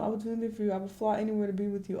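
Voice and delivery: fast gushing read; rushed reading. Acted. Reading the fan's texts aloud, rushed. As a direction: rattle off his texts fast.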